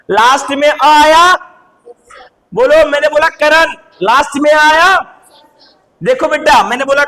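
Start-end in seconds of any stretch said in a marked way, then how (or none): none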